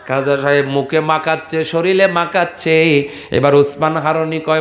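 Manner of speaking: normal talking voice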